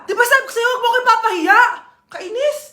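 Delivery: high-pitched, irritated hushed scolding; annoyed scolding. Acted. Child scolds embarrassing mother.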